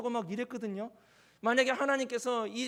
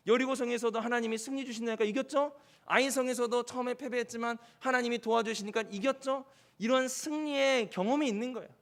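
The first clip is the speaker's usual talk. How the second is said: ordinary talk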